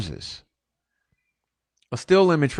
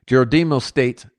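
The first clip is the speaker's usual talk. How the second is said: usual speaking voice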